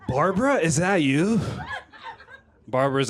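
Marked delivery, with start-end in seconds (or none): 0.1-1.4 drawn-out, higher, rising question; astonished greeting to someone thought dead; surprised recognition. Acted. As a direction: slowly call out in amazed recognition. Acting out people spotting lost friends.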